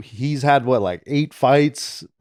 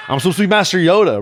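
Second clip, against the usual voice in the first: ordinary talk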